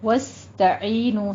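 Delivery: وَاسْتَعِينُوا with a whistling س; tajweed demo. Shown as a demonstration. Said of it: "سین پہ سیٹی کی آواز آئے گی". (وَاسْتَعِينُوا میں سین پر سیٹی کی آواز)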